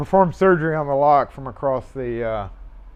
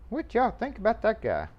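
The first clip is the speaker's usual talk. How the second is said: asked again, higher and playful; higher repeat; 6 semitones higher, 6 dB quieter than usual. Real, not acted. Asking the viewers again about the mail guy, higher and playful. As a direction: pitch it up, teasing the viewers.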